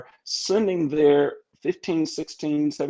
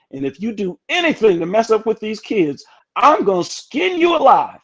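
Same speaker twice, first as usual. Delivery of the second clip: louder, higher hammed-up threat; mock stern warning; 7 semitones higher, 6 dB louder than usual. Dean warning her new young hire, hammed-up stern threat. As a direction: build up to a comic scolding on the last words.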